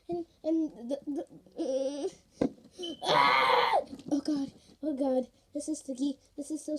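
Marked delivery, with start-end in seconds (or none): 3.0-3.8 panicked yelp. Real, not acted. Panic while slime sticks.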